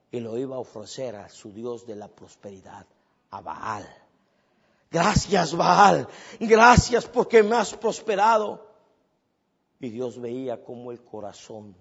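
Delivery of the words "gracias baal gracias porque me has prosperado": grateful worshipper. Acted. Gomer thanking idol Baal.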